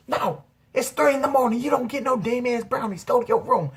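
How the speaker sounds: high, loud imitation of a scolding mother; screaming angry mom. Mom refusing son brownies, imitated angry yelling. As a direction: yell it like a fed-up mom. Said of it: "she's screaming and yelling at me".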